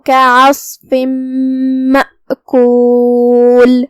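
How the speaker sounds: slow, measured tajweed chanting; slow melodic Quran recitation. Shown as a demonstration. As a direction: draw out each vowel, melodic recitation. Teacher recites Quran verses.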